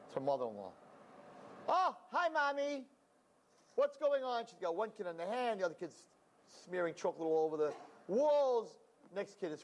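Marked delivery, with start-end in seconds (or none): 1.7-4.4 high, sugary phone greeting; overly sweet hello on the phone; sweet daughter-in-law. Retold or imitated. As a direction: chirp hello to keep her mother-in-law happy.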